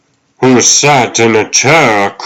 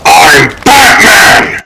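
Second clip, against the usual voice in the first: slow, loud, higher triumphant cry; defiant shout; 7 semitones higher, 4 dB louder, slower than usual. Acted. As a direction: roar your identity back at him. Bruce defiant triumphant declaration.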